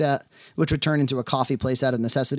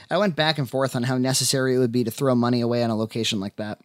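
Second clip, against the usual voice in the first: ordinary delivery, nothing marked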